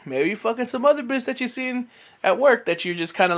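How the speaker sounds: ordinary talk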